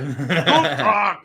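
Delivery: put-on slow, laughing German accent greeting. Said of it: "just wait till my German accent".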